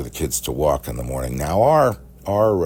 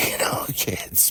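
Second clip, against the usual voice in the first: whispered aside; 6 semitones higher, 4 dB quieter, slower than usual. Real, not acted. Aside after laugh.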